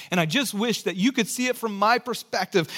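ordinary delivery

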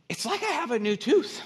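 very high, loud, astonished cry; hammed-up amazement, pitch soaring; amazed. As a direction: imitate a dad stunned by a miracle. Acting dad amazed at healing.

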